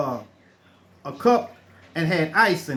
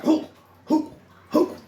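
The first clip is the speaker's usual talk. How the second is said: high, punchy grunts for each thrust; effortful grunted thrusts; grunting thrust sounds; 9 semitones higher than usual. Retold or imitated. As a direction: grunt out each push hard. Acting out Heimlich thrusts.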